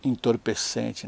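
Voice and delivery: ordinary talk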